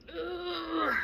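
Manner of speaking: ordinary talk, nothing marked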